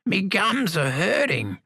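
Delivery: put-on high, slow, stubborn old voice; high stubborn old man; cranky old man. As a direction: grumble like an obstinate old lag. Samuel complains.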